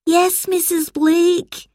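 high, slow dutiful reply; drawn-out obedient schoolchildren's voice; children in chorus. A narrator's reading. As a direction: answer the teacher in sing-song like a class. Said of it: "replied the children".

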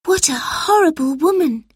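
put-on high, hushed little-girl voice; girl's private thought. A narrator's reading. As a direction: think it aloud in a high voice. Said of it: "thought Lucy".

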